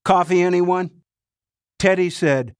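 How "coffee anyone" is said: loud, higher call across the room; loud offer. Acted. Kritz offering coffee to the room, loud and high. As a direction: Kritz breaking the tension by calling out. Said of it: "Kritz said loudly".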